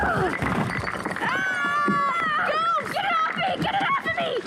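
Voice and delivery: high panicked shrieking; panicked scream. Acted. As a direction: scream in terror, high and shrill. Attacked by a skeleton.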